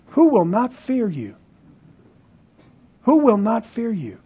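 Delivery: soft, measured rhetorical question; quiet and grave, with long pauses; hushed question. Acted. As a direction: drop the volume and let the question hang. Rhetorical question repeated softly to congregation.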